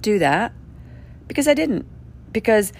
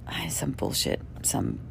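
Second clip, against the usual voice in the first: normal talking voice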